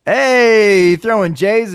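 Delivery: loud, high hey; shouting hey at the guest; excited shout. Real, not acted. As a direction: burst out with hey.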